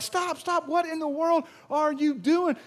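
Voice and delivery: high, loud panicked cry of stop; alarmed shout. Retold or imitated. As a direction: yell it as they rush in. Psychiatrists rushing in alarmed.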